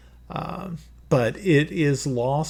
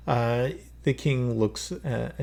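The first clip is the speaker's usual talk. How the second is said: usual speaking voice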